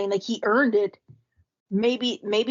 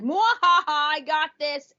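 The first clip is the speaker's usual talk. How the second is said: mad scientist; 6 semitones higher, 4 dB louder than usual. Pretend. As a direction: cackle like a smug villain. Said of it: "Blitz is gonna be like".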